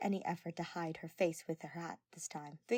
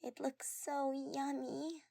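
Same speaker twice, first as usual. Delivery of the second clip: dazzled by cake; 8 semitones higher, much slower than usual. Acted. Dazed girl admiring the cake.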